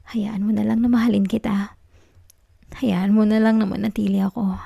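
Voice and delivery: hushed pleading whisper. Acted. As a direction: whisper it close, clinging. Possessive girlfriend pleading softly. (pabulong na pakiusap)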